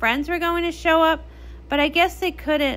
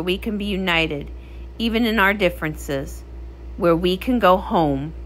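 low, grave royal speech; a queen's solemn oath over music; solemn proclamation; 5 semitones lower than usual. Acted. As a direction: declare it like a vow to the oceans.

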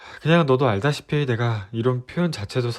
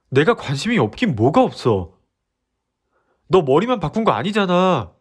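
higher and louder, frustrated; exasperated outburst; 5 semitones higher, 4 dB louder than usual. Acted. Boyfriend snapping back at girlfriend, loud and exasperated. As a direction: blurt it out, fed up and hurt.

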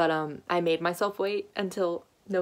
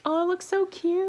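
much higher and slow, cooing over how cute it is; gushing delight; 12 semitones higher, 4 dB louder than usual. Real, not acted. Seeing the finished phone case, cooing high and slow. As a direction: coo it like you just saw a puppy.

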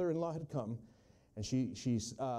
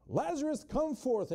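much higher, ringing command; powerful call; 10 semitones higher than usual. Acted. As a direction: call Lazarus out with full power. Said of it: "that voice of Jesus is just powerful".

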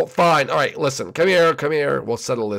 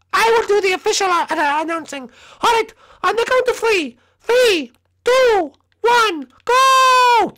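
very high cartoon announcer voice; high-pitched official countdown; squeaky announcer; 6 dB louder than usual. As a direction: count down in a tiny, piercing voice.

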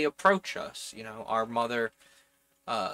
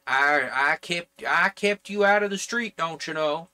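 put-on louder, higher, indignant off-target Jersey accent; wrong New Jersey accent; 5 semitones higher, 6 dB louder than usual. Relative guilt-tripping their kin, in a wrong New Jersey accent. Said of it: "that's the wrong accent for New Jersey".

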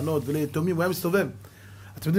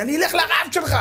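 higher, indignant shriek of a woman; retold scolding yell; 14 semitones higher, 4 dB louder than usual. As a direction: threaten him like an angry mourner. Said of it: "באה צועקת עליי". (צעקה נזופה של אישה, גבוהה)

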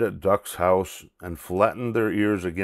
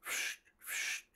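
soft voiceless whoosh, twice; hushed puffs of air for the mystery noise; imitated snoring whoosh; 18 dB quieter than usual. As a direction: make two very quiet whooshes. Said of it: "It goes".

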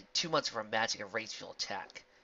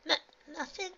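shaky stammer. Acted. Shaken pony stammers.

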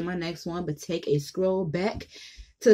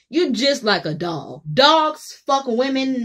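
ordinary talk

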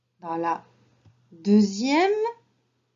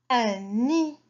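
usual speaking voice

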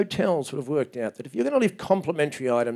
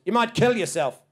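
desk clerk; 7 semitones higher than usual. Retold or imitated. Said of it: "She goes".

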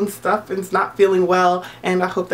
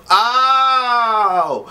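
high, loud, startled "Oh!"; a sudden squeal of surprise; surprised gasp; 7 semitones higher, 3 dB louder than usual. Retold or imitated. Vlogger recalling seeing the channel, high startled "Oh!". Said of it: "I was like".

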